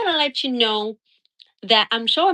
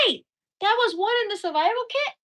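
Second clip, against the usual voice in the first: quick, much higher mock astonished question; retold surprise; 10 semitones higher, faster than usual. Imitating Johnny finding the fork, surprised and quick. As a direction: play him delighted and caught off guard. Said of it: "He's like".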